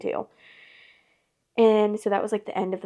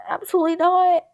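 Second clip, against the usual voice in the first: loud, high-pitched refusal; emphatic retold outburst of disbelief; indignant denial; 10 semitones higher, 10 dB louder than usual. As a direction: protest it loudly, pitch way up.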